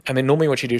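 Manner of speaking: normal talking voice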